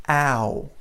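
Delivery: "ow" as the American glide; correct ow. Shown as a demonstration. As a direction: model "ow" as the right sound. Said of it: "We do".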